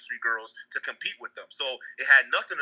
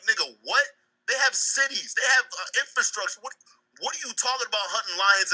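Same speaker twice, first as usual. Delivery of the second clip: retold disbelief, pitched up high; incredulous retort; 7 semitones higher than usual. Acts out his incredulous comeback.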